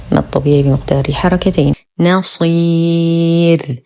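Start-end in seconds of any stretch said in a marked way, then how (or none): 2.0-3.8 نصير very slow, mild heavy ص, long ي, thin ر; lightly heavy saad. Shown as a demonstration. Teacher shows the verse ending, long madd and thinned raa. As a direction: stretch نصير and stop on a light ر. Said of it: "نفخم الصاد بأقل درجات التفخيم".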